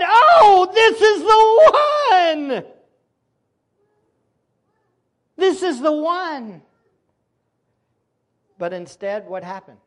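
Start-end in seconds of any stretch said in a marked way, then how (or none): 0.0-6.6 high, loud, slow cry of joy; jubilant shout. Pretend. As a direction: shout it like a herald with trumpets. Priests should have rejoiced.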